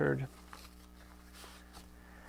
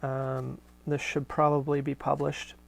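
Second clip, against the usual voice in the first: ordinary talk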